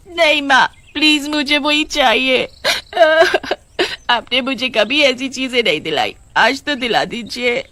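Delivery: put-on high childish pleading voice; a little girl whining for a treat; young daughter voice. Daughter begs her mother at the shop, high and childish.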